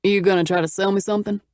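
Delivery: a hint of a Texan man, teasing; Texas accent. A narrator's reading. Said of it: "He had a heavy Texas accent".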